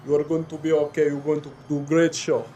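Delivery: put-on strong man soothing the tiger in a foreign accent; accented strongman crooning to his animals. Strong man comforting the tiger before the show, soothing accented voice. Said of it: "he'll go by the tiger and go".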